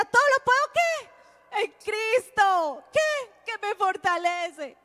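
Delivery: put-on a mother pushing her child to finish the verse; coaxing mother. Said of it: "y ella". (mamá insistente con voz mucho más aguda)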